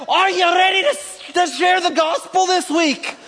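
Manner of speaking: high, hammed-up Scottish rallying speech; butchered Scottish accent. Preacher rallying church to share gospel, butchered Scottish accent. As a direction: fire up troops in a fake Scottish accent. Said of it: "butcher some sort of accent".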